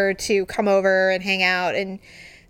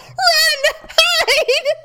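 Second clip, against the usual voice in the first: much higher, laughing panic yell; panicked yell. Retold or imitated. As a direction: shout at them to scatter. Panicked command to flee and hide.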